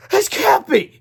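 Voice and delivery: very high, loud cry of disbelief; disbelieving cry. Acted. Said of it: "he cried".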